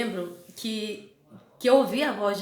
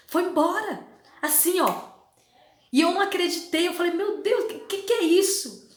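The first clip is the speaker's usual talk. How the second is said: amazed; 8 semitones higher, 4 dB louder than usual. Real, not acted. Amazed at sudden relief.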